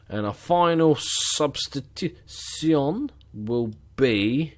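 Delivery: drawn-out announcer build-up with big pauses; mock stadium announcer. Streamer announcing his final substitution, mock announcer voice. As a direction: announce it slowly, stretching for suspense.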